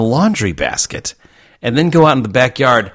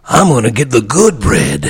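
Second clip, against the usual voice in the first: George Carlin. Retold or imitated. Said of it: "has got the old George Carlin".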